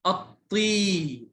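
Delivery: أطي with the final ee held long; slow teacher's model of long-vowel أطي. Shown as a demonstration. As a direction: stretch the ee of أطي slowly. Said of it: "صوت طويل مع ال".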